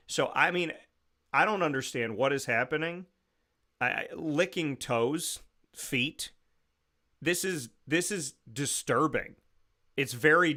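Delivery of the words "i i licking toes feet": ordinary talk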